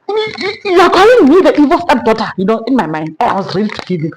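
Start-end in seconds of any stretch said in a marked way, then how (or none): 0.1-2.3 higher, louder, offended stammering comeback; indignant retort. Retold or imitated. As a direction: fire back, insulted. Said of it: "I am coming with fire".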